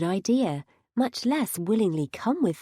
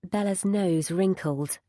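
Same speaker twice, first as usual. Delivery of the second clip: ordinary delivery, nothing marked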